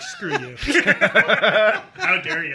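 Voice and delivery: joking outrage breaking into a high laugh; playfully offended, cracking up; mock offense. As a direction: laugh through a pretend indignant comeback. Mock comeback to a compliment.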